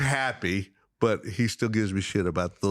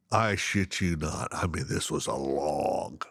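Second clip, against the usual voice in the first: ordinary delivery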